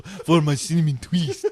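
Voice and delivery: slow, grand vampire voice. Pretend. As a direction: declare it like a pompous count. Vampire voice continued.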